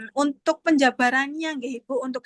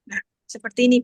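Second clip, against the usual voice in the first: ordinary delivery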